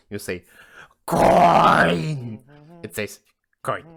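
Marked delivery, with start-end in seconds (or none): 1.1-2.4 high, long-held loud yell; crazed drawn-out cry; shouting. Acted. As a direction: bellow it, stretching the vowel. Escalating shouting exchange with coin.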